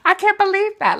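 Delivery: high, loud indignant squeal; fed-up squeal at a telemarketer; outraged disbelief. Real, not acted. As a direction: shriek in disbelief. Venting about telemarketer call.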